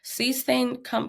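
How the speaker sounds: ordinary talk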